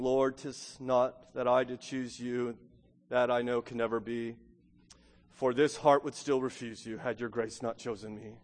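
low, soft recital of a hymn; hymn recitation. Acted. Preacher recalls a hymn to the congregation, hushed recitation. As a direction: recite it reverently, quiet and measured.

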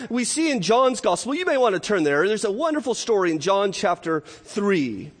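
ordinary delivery

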